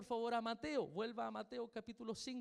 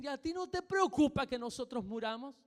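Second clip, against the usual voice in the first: louder, indignant complaint; loud reproach; 4 semitones higher, 7 dB louder than usual. Acted. Disciples waking Jesus with a loud reproach. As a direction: demand an answer from him. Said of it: "Lo van a despertar así fuerte".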